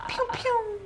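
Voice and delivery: high, sharp pew-pew firework sounds; fireworks noise. Shown as a demonstration. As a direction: zap out two little firework pops. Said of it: "that was my impression of a firework".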